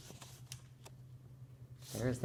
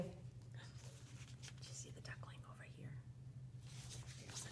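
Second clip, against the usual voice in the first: put-on whispered question to young children; barely audible, voiceless whisper; hushed whisper; 21 dB quieter than usual. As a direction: whisper it like a secret search. Hushed searching with young listeners.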